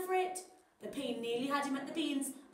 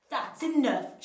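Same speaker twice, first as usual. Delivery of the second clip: louder commanding hero cry; hero shout; 8 dB louder, slower than usual. Acted. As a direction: stop the villain firmly. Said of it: "shouted Sweet Potato".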